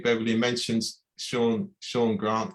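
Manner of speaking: ordinary delivery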